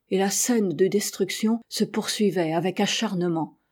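ordinary delivery

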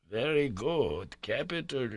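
soft, slow murmur to himself. A narrator's reading. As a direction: mutter it half under the breath, pleased. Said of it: "murmured my uncle".

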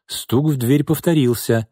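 plain reading voice

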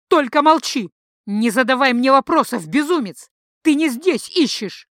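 loud, high raving command; wild prophetic outburst; frenzied shout. Acted. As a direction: shout like a seer seized by a vision. Priestess raving in a prophetic fit.

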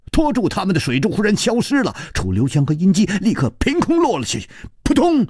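fast, high, urgent narration; sudden action. A narrator's reading. Narrator racing through the sudden fall into water. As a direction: rush it as they drop.

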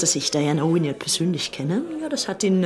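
normal talking voice

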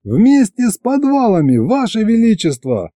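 high-pitched eager exclamation; bursting with a new idea; excited outburst. A narrator's reading. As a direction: call it out to the ruler, thrilled. Hero jumps at the idea.

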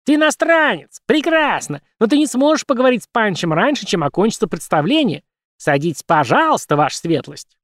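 high cartoon squeak; squeaky puppet voice. Puppet Punch speaking.

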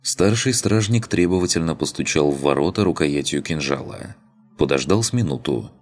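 plain reading voice

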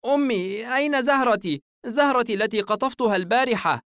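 put-on high, worried little boy; anxious child. A narrator's reading. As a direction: ask mother like a child who lost a treasure. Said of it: "الطفل بقلق".

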